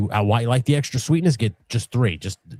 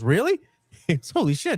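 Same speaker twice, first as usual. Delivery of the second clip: amazed friend; 12 semitones higher than usual. Retold or imitated. Friend voiced in story.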